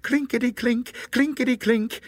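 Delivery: high, crisp rattling coins; high-pitched clinking singsong; imitated coin rattle. A narrator's reading. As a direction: chime it high like jingling coins. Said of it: "it went".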